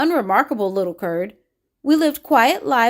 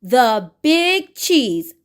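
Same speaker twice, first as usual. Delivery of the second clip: very slow, stretched proud announcement; drawn-out grand title; much slower than usual. Shown as a demonstration. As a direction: draw out every word like a royal title. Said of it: "say it with me".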